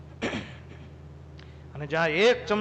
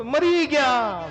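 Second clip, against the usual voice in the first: higher, louder, slowly stretched groan; loud moan; 6 semitones higher, 6 dB louder, much slower than usual. Retold or imitated. Complainer groaning loudly about the summer heat. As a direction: wail it out like summer heat is unbearable. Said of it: "પાછો શું કહે ખબર?".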